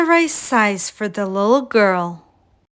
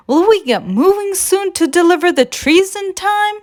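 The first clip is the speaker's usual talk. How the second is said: put-on much higher childlike sleigh voice; small sleigh voiced high and keen; eager Little Red; 10 semitones higher than usual. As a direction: ask with hopeful eagerness.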